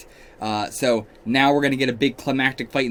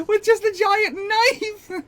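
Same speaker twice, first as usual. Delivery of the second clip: high, slow amazed shriek; amazed exclamation; 5 dB louder, slower than usual. Real, not acted. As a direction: squeal it out in disbelief. Shocked at costume image.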